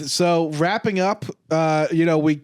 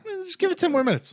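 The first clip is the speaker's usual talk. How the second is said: much higher and fast, goofy reassurance; imitating Oswald; 14 semitones higher, much faster than usual. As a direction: toss it off in a silly high voice. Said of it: "Oswald's like".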